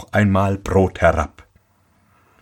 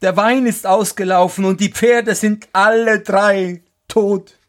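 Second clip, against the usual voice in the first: high-pitched tale of woe; lamenting carter; 11 semitones higher than usual. A narrator's reading. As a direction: report the losses in a high lament. Carter laments losses to wife. (hohe, klagende Stimme)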